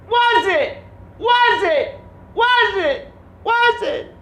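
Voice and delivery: very high, loud, slow squealed cry; falsetto shriek. Pretend. Vlogger squeals about his ruined leg in falsetto. Repeated silly high question.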